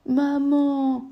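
high, lightly voiced toddler whine; a small child whining for mom; whimpering baby. A baby on the roof whining for its mother, lightly voiced. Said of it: "pleurnicha le bébé".

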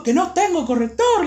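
high, drawn-out exasperated retort; annoyed retort. Retold or imitated. Student to pestering classmate, high exasperated retort. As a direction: turn round and protest, high and slow.

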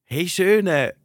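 put-on greeting from a forward guy; flirty stranger. Stranger putting his arm round her, flirty greeting.

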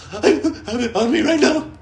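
bawling answer to a simple request; tearful whine. Retold or imitated. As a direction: whimper like you're about to cry. Tearful reply to boss.

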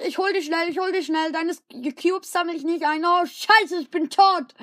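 hyped-up live match commentary; excited gaming. Acted. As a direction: play it live and panicked.

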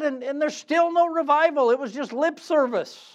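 strained high complaint; frustrated pleading Elijah. Acted. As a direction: cry out in exasperation at God. Preacher continuing Elijah's complaint.

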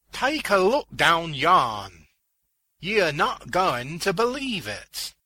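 high, loud, slow character voice; slow, high knight urging them to look; chubby knight. Acted. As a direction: draw it out high and loud like a knight with big news. Chubby knight points them downstairs.

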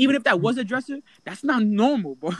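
high-pitched, incredulous arguing; exasperated retold protest. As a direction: argue back with pitch shooting up. Retold argument with mom.

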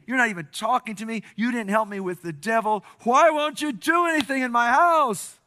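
high-pitched tirade building to a loud shout; exasperated. Acted. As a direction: let the grievances pile up, then explode. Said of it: "He gets so exasperated".

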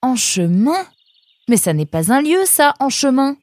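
répéter, stupéfait, en montant; Nemrod incrédule. A narrator's reading. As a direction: question aiguë et forte, incrédule. Nemrod incredulous. (Nemrod reprend les mots de Mirko, voix aiguë incrédule)

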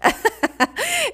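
high-pitched burst of laughter; carcajada. Real, not acted. Se ríe de la muchacha. (carcajada aguda)

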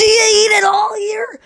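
high, loud cartoon yell; Shaggy impression. Kid doing Shaggy for friends, high cartoon yell. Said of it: "I'm Shaggy!".

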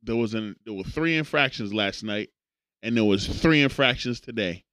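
lightly voiced deadpan assistant; low, soft, matter-of-fact announcement; Lana. Device telling contestants about infractions, low deadpan voice. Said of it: "And then she'll be like".

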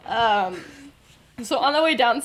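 usual speaking voice

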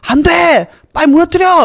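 high, loud desperate yell; panicked shout. Retold or imitated. Lab staff panic as the button fails, high shout. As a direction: yell it like everything's lost. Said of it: "이러단 말이에요".

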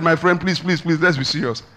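exasperated pleading, acted out. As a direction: beg an unwilling friend to see sense. Retelling a friend's insistent plea.

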